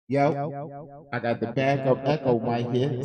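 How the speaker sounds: talking into an echo mic. Real, not acted. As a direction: voice through a toy echo microphone. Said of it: "I got my backup Echo mic".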